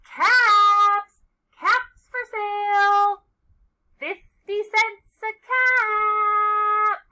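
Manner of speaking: high, loud street-seller's cry; slow singsong vendor's call. A narrator's reading. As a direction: hawk caps with long held vowels. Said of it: "he walked back to town calling".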